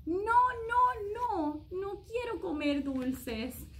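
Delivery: high pleading no no no; whiny child refusal. A narrator's reading. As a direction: protest like a stubborn little kid.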